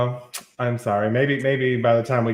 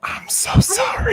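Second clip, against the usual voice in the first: loud unvoiced whisper, high; whisper-shout an apology; whisper yelling; 3 dB louder, slower than usual. Real, not acted. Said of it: "Not the whisper shouting into the microphone".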